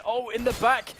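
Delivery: surprised shout. Real, not acted. Exclaimed mid-action.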